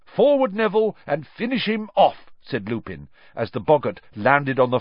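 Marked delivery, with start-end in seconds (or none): 0.1-2.3 high, raised rallying order; urging command. Acted. Professor sending pupil back at boggart, urging command. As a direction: send the pupil in to finish it.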